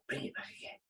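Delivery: very soft, hushed echo. Real, not acted. Bringing back a memorized moment of bliss, hushed. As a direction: drop to a near-whisper.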